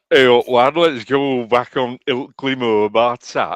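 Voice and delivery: mock Northern English accent; exaggerated Northern accent. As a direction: ham up a broad Yorkshire greeting. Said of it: "Yeah, it's all that".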